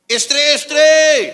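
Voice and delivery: very loud, high, drawn-out call. Real, not acted. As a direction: holler to rouse the crowd. Calling wives in the congregation. (seruan lantang, tinggi, dan panjang)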